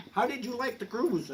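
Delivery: lightly voiced wife asking. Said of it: "she says to me".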